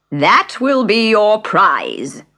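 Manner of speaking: loud, very slow pompous bird; solemnly award the prize; dodo voice. Acted. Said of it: "said the dodo".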